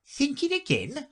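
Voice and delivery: Duchess voice. A narrator's reading.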